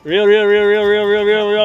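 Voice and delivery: loud, urgent chant of reel; excited shouting. Real, not acted. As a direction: hurry him on, shouting. Urging partner to reel fish.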